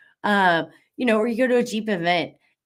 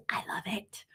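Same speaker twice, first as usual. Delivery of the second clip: breathy defiant whisper; playful whisper; whispered aside; 13 dB quieter than usual. Real, not acted. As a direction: whisper it like a secret. Defiant aside about her bumper.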